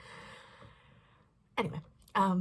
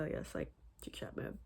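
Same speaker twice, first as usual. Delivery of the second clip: whispering; 13 semitones lower, 9 dB quieter than usual. Real, not acted. Aside while switching to chat mode.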